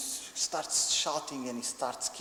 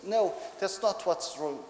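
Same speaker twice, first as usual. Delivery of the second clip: slow, raised mock-angry refusal; upset little boy; 6 semitones higher, 4 dB louder, slower than usual. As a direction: refuse like a cross toddler. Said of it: "he gets very upset with me".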